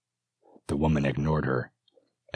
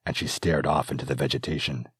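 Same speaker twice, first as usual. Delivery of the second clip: ordinary delivery, nothing marked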